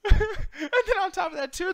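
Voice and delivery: high, loud, laughing agreement; giggly, excited outburst; talking through laughter. Real, not acted. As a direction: push on while cracking up. Tries continuing while cracking up.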